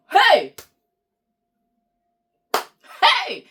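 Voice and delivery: sharp loud call across the room. Real, not acted. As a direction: shout hey to get attention. Calling out to someone nearby.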